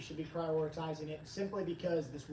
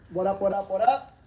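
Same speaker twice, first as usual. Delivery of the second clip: loud, rising what up, louder; hyped greeting; 9 dB louder than usual. Acted. Host hyping up live viewers, loud rising greeting. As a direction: call out to the crowd with a grin.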